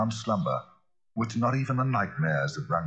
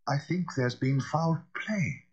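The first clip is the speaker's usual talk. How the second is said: hoarse; 8 semitones higher, slower than usual. A narrator's reading. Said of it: "said Poole hoarsely".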